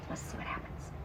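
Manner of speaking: hushed suspense whisper; secretive whisper. Acted. Teasing suspense to children.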